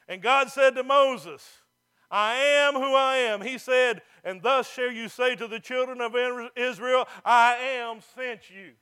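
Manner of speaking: slow, loud reading of God's words; scripture proclamation. Acted. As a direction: proclaim each word.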